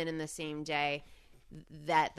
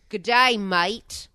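slow, drawn-out Australian "Good day, mate"; a bad Australian accent, stretched out loud; Australian accent, hammed-up and slow; 3 semitones higher, 9 dB louder, much slower than usual. Shown as a demonstration. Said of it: "I can't, like".

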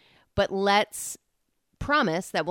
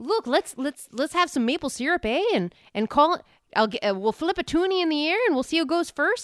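put-on Canadian accent; 6 semitones higher than usual. Hockey team banter in Canadian accent.

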